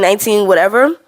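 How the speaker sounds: usual speaking voice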